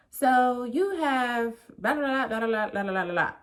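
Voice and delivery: imitated medical gobbledygook, loud and overblown; mock doctor jargon. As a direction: parody a doctor reeling off jargon. Voicing doctor's incomprehensible medical jargon.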